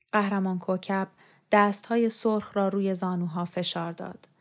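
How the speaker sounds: usual speaking voice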